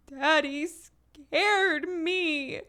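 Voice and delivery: put-on high, very slow little-girl whimper; a small girl tattling, slow and high; toddler voice. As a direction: play the upset small child. Imitating toddler's complaint.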